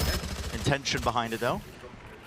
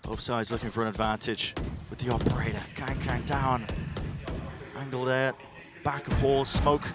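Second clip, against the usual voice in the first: esports caster; 5 semitones higher than usual. Real, not acted. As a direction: ride the clutch to a shout. Live play-by-play call, hype build.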